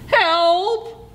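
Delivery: high, drawn-out cry; shouting for help. A narrator's reading. Coyote sees the rock again, shouting for help. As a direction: call help high and urgent. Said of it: "shouted Coyote".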